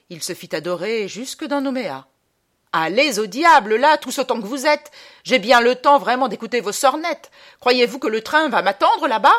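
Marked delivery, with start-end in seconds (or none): 2.7-9.3 high, loud angry rant; furious tirade. Acted. Traveller cursing the mourners as his train waits, furious tirade. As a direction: tell them off at full voice.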